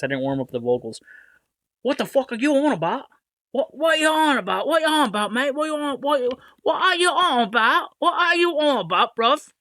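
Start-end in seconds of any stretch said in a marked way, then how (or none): 1.8-9.5 high, exaggerated Cockney geezer; Cockney accent. Host redoing the TikTok line as a hammed-up Cockney. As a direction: ham up a London bloke demanding answers. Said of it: "Sorry, I didn't practice".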